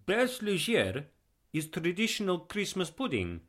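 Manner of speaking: high-pitched, earnest Eastern European voice; proud Eastern European guest; heavy Eastern European accent. A narrator's reading. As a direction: present your gift in a thick Eastern European accent. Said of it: "in a heavy Eastern European accent".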